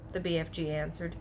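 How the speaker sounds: usual speaking voice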